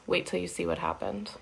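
plain reading voice

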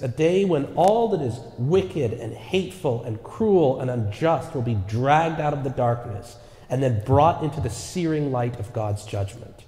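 ordinary talk, nothing marked

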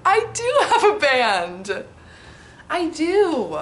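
loud, high, laughing delight; gleeful laughing shout; laughing excitement. Real, not acted. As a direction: burst out laughing with joy. Excited answer to old self.

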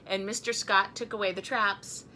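usual speaking voice

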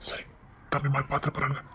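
soft unvoiced whisper; husband whispering a warning to his wife. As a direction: whisper so the others won't hear. Whispers, others might see.

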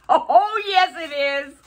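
high, loud burst of delight; loud, high-pitched glee; thrilled exclamation. Real, not acted. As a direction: cry it out in real excitement. Thrilled by queen gift.

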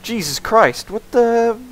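startled, rising to a high yell; shocked shout. Real, not acted. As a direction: caught off guard, yelling. Shocked by sudden game attack.

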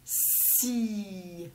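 "Si" with a long s gliding into a held i; soft, slow "Si", hissed s then long falling i; sounds drawn out. Shown as a demonstration. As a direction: stretch "Si" softly so each sound is heard. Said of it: "On entend si".

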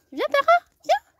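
high, loud call to a dog; yelling across the room. Real, not acted. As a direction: call her in from far off. Calling the dog.

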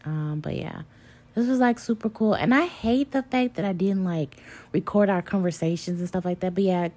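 ordinary talk, nothing marked

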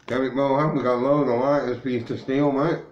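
loud, high call across to a mate. Real, not acted. Calling out to Derek about the lighters, loud and high. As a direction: shout it out so Derek hears.

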